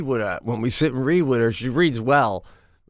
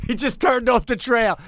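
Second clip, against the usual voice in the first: mock alarm; 7 semitones higher than usual. Operator reports Biden straying, first take.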